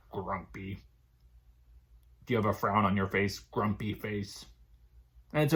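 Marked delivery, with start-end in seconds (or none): 0.1-0.8 grumpy. Shown as a demonstration. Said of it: "Can you make a grumpy face?". 3.4-4.5 grumpy. Shown as a demonstration. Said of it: "Can you make a grumpy face?".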